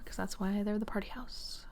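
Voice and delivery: breathy unvoiced whisper; whispered aside. Real, not acted. Telling viewers why it's the party house, whispered. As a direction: drop to a secret whisper.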